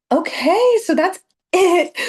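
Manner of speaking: high, loud, bright wrap-up call; excited sign-off. Real, not acted. Coach cheerfully wrapping up her video for viewers, high and loud. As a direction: burst out with the ending like a cheer.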